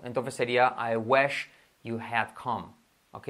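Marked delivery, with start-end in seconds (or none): 0.8-2.7 slow, word-by-word English example; slow model sentence. Shown as a demonstration. Teacher first models the translation for learners, slowly. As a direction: separate each word unhurriedly.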